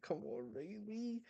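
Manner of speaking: very high, soft squeaky plea; mock pleading. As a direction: plead in a tiny high voice, like an anxious fan. Fan's inner plea to character.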